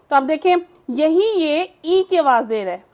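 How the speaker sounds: usual speaking voice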